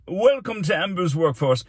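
loud, high ceremonial welcome; pompous mayor. Acted. Mayor welcoming students to work, pompous proclamation.

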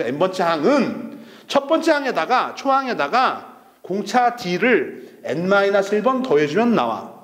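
slow, deliberate model reading; 올바른 읽기 시범. Shown as a demonstration. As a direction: spell out the meaning phrase by phrase. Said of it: "이렇게 읽는 거죠". (뜻을 짚어가며 천천히 또박또박)

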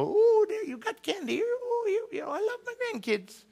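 very high, cooing granddad voice; doting old grandpa. Retold or imitated. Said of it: "the old grandpa".

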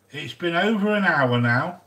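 usual speaking voice

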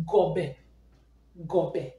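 Gobe as two syllables, "gaw-beh", falling; model pronunciation. Shown as a demonstration. Teacher modelling one word for learners, Gobe as falling "gaw-beh". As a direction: say Gobe low with open vowels, "gaw-beh". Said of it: "the pronunciation is what?".

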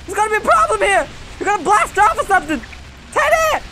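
high, fast cries of warning; excited alarm. Real, not acted. Gamer warns Teddy not to go Super Saiyan, excited alarm. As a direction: call out in rising panic, hyped up. Calling out to game character.

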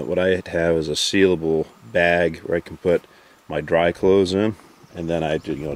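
ordinary talk

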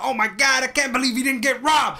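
high, shouted mock disbelief; hammed-up incredulous shout; retold amazement. Acts out stepdad's amazed reaction.

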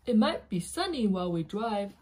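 plain reading voice

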